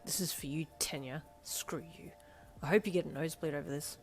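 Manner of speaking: seethe under your breath; muttered spite, held down; seething. A narrator's reading. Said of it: "you seethed".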